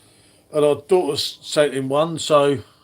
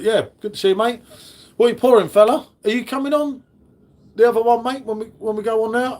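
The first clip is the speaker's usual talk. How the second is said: raised, higher call across to a mate; hearty greeting; 6 semitones higher than usual. Real, not acted. Calling out to a mate on stream.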